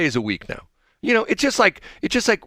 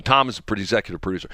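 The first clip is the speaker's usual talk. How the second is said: ordinary delivery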